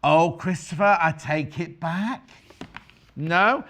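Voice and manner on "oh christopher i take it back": ordinary delivery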